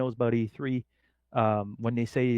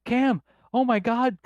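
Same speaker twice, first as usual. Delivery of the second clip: high, loud, drawn-out gush of excitement; imitating a stranger's overjoyed fan shriek; freaking out; 11 semitones higher, 5 dB louder, slower than usual. Lady recognizing the guest as Camshaft, high excited squeal. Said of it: "all freaking out".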